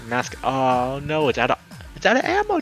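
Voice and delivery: usual speaking voice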